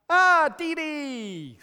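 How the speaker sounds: put-on high, loud, drawn-out baby call; toddler voice. Grandson greeting grandpa on the video call, toddler squeal.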